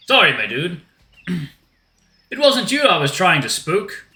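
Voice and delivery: put-on loud apologetic dude in rough Australian accent; attempted Australian accent. Shark apologizes to Dom, attempted Australian accent. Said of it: "Maybe an Australian accent will do".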